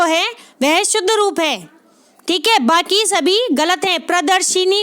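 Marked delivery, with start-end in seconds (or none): 4.1-4.8 प्रदर्शिनी with an i after the sh, "shi-ni"; wrong pronunciation. Shown as a demonstration. Teacher showing the common mistake, i sounded after sh. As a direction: say प्रदर्शिनी ending in shi-nee. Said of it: "नहीं होता".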